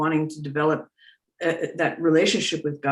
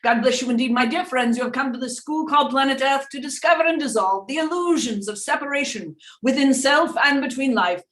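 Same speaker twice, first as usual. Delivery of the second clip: seance medium; 6 semitones higher than usual. Acted. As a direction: speak as a spirit guide through a medium. Channels Doctor Peebles voice.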